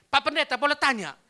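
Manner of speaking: put-on loud, high question with Manado accent; retold Manado-accented question. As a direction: ask the pastor loudly in Manado lilt. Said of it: "Tapi dia pakai logat Manado".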